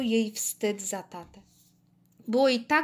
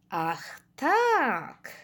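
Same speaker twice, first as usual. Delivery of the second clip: drawn-out, sighed reply; sighing; 3 semitones higher than usual. A narrator's reading. Dad stumped by his daughter's answer, sighing. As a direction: sigh it out, dumbfounded. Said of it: "westchnął".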